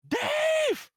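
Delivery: very high, loud shrieking yell; shrieky scream. Acted. Angry woman yelling for Dave from the kitchen window, shrieky scream. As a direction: shriek the name at the top of your voice. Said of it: "a very high, very shrieky scream as she yells".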